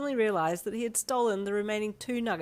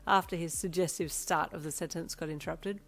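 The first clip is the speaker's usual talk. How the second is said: plain reading voice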